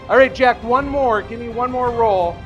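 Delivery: ordinary talk, nothing marked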